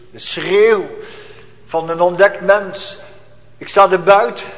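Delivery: loud, high desperate shout to heaven; anguished cry. Acted. (luide wanhoopskreet)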